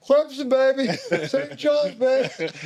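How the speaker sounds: high, loud retold crowing; gloating taunt. Player showing off his college shorts under practice gear, gloating taunt. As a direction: crow it in mock triumph, half laughing.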